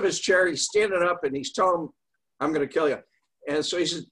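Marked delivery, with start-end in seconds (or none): none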